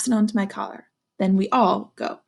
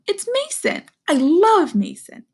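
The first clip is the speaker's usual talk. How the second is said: happy Kayla; 10 semitones higher, 4 dB louder than usual. Acted. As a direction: greet a favourite friend with glee. Kayla happy to see Mason.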